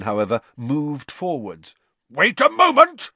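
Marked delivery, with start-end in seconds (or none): 2.1-3.1 high, loud bellow. A narrator's reading. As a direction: roar it at the fireplace. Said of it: "he bellowed at the fire".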